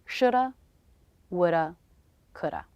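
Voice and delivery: careful teacher demo of shoulda woulda coulda; reduced modal forms. Shown as a demonstration. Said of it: "These reductions sound like natural".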